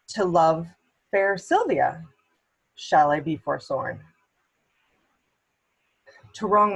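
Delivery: theatrical soliloquy with deliberate pauses, pitch climbing toward the end; Proteus, torn lover. Acted. Proteus deciding between two women, torn stage soliloquy.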